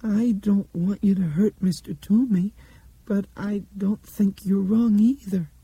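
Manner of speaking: put-on high little girl's voice, slowing; small, tremulous, pitched very high; small, wavery voice. A narrator's reading. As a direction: speak up timidly in a high, wavering voice. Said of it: "in a small, wavery voice".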